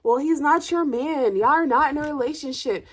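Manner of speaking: imitating the friend shrugging it off; mock dismissive friend. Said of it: "she's like".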